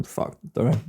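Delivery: whispered curse under breath. Real, not acted. Muttered aside while driving.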